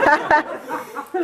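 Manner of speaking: quick rising giggle; real amused laugh; short laugh. Real, not acted. Reader laughs again.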